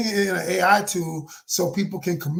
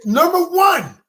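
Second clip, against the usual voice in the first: shouted point; 9 semitones higher, 4 dB louder, slower than usual. Retold or imitated. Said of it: "I said".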